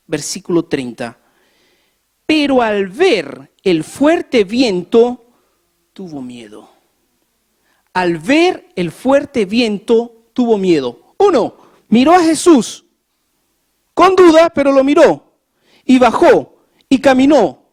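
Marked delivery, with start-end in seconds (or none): 2.3-6.7 slow emphatic reading. Shown as a demonstration. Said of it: "lectura enfática repetida". 7.9-11.1 slow, weighty reading. Shown as a demonstration. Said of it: "repite la frase más despacio". 11.2-17.6 much louder and higher, fervent preaching; preaching at full force. Acted. Predica a plena voz.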